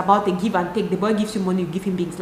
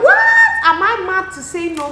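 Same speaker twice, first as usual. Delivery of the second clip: put-on indignant girls; 9 semitones higher, 4 dB louder than usual. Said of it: "But girls were like".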